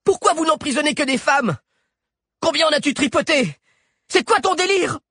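high, loud accusing heroine; angry young woman. A narrator's reading. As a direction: demand answers, furious. Alix accuses Galien.

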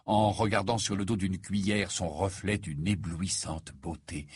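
ordinary delivery, nothing marked

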